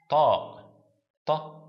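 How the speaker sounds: "Ta, ta" with the heavy Arabic emphatic t. Shown as a demonstration. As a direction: demonstrate "ta" with a backed, emphatic t. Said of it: "it's like an emphatic t".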